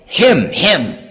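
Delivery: "Him, him" as two separate stressed beats; "him" doubled for emphasis, each one punched; emphatic repetition. Shown as a demonstration. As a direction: say "Him, him" twice over, each falling firmly. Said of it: "what the emphatic pronoun is".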